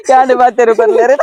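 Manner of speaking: usual speaking voice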